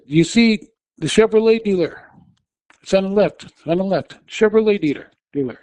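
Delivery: high, loud pointing out the dealer; urgent calling. Retold or imitated. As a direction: hammer the landmark at him. Urgently directing dazed driver.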